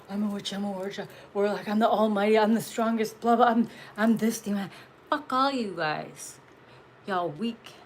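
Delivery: a hint of a pompous self-proclaimed witch; mocking their grand boasts lightly; mock boastful witch. Mocking fake witches bragging to her viewers, lightly pompous.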